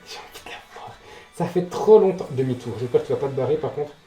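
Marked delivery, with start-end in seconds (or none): 0.0-0.9 breathless whisper. Real, not acted. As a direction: whisper the wish. Whispered wish.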